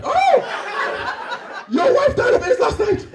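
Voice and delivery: very high, shrieking African disbelief; African accent. Acted. Friend reacts to death last night, high shocked African accent. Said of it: "Two Africans that haven't seen each other".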